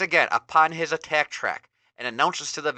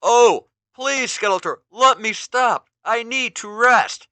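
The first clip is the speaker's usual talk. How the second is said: much higher, louder, slow exhausted plea; pleading Ram Man; 9 semitones higher, 9 dB louder than usual. Acted. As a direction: beg to stop, worn out. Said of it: "pleads for Skeletor".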